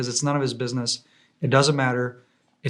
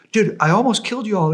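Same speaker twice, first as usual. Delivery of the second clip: higher, louder panicked cry; freaking out; 8 semitones higher, 4 dB louder than usual. Retold or imitated. The American soldier to the drone, higher panicked outburst. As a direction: play the guy who just lost it.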